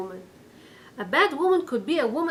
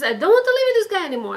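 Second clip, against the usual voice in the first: fast, loud, higher exasperated burst; rapid exasperated complaint, louder and higher; fed-up outburst; 7 semitones higher, 7 dB louder, much faster than usual. Retold or imitated. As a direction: blurt it out, done with him. Wife fed up with husband.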